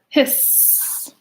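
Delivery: long hiss; long breathy hiss; drawn-out whispered hiss. A narrator's reading. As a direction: stretch the hiss out. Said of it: "louder still".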